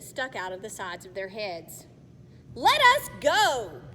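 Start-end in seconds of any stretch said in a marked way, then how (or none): 2.5-3.8 louder, slow, forceful demand. Acted. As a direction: insist on being freed. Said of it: "Connor demanded".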